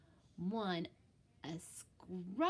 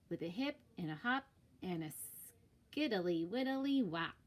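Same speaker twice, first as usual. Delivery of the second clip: ordinary delivery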